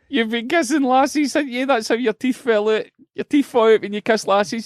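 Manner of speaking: put-on high, sing-song teasing of a wee boy; teasing shop worker. Shop worker teasing a child about kissing lassies, high sing-song. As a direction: tease him like a cheeky shop assistant.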